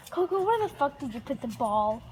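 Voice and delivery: ordinary delivery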